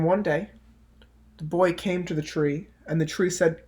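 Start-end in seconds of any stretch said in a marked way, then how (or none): none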